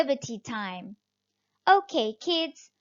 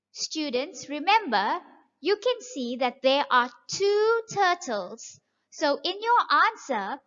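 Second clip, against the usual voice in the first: usual speaking voice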